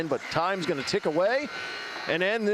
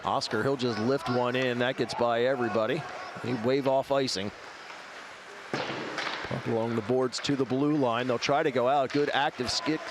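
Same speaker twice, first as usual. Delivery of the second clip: live hockey play-by-play; 2 semitones lower than usual. Acted. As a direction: call the action live. Play-by-play of game.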